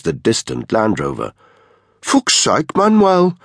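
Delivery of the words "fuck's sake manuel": high-pitched, exasperated sneer; scornful jeer at an underling; unpleasant sneer. A narrator's reading. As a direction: sneer it contemptuously, pitched well up. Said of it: "said with an unpleasant sneer".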